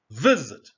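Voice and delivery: VISIT with a loud VIS; first-syllable stress. Shown as a demonstration. Teacher gives another example, VISIT punched on VIS. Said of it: "the stress is not".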